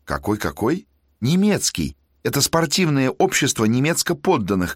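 high, eager tenor; excited student. Acted. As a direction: rattle off the news eagerly.

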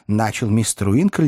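ordinary delivery, nothing marked

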